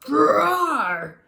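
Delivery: loud, high growled roar; young lion roars, loud; fierce lion growl. Acted. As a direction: roar to keep the ride going. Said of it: "the young lion said".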